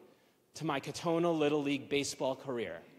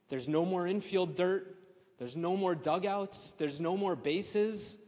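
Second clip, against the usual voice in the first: ordinary talk, nothing marked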